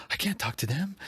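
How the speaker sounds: high, flustered imitation of mom; panicking. Mom refusing the interviewer's call, panicking. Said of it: "and she was panicking".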